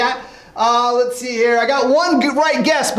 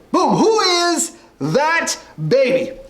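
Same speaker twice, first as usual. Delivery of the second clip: drawn-out host call, higher and slow; game show announcer; 5 semitones higher, slower than usual. Acted. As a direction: stretch the catchphrase like a quiz host. Host announces the next baby.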